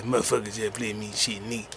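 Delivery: low, soft gruff mutter; a lightly done low Charlie voice; Charlie impression. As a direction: drop low like Charlie Murphy. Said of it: "I just sounded like Charlie".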